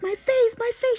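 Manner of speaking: high, loud tearful wail; crying. Retold or imitated. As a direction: sob out the words as if the skin is coming off. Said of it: "She's crying".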